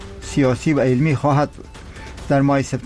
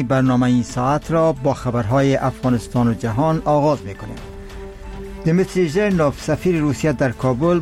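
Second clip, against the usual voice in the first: iranian newsreader. Acted. Radio news bulletin read.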